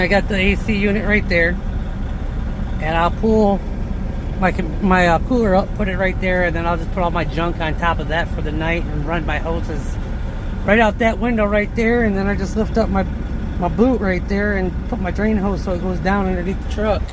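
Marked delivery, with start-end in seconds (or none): none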